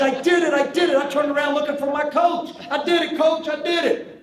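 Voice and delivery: very high, loud triumphant cry; a kid yelling his first hit to the coach; overjoyed shouting. Retold or imitated. Boy celebrates first hit.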